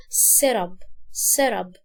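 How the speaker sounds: سيرب as "serab", low and falling; light short vowel. Shown as a demonstration. Teacher correcting the mistake, short unstressed vowel in سيرب. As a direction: say سيرب with no stretched "ee". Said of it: "كسرة مش قوية جدًا جدًا".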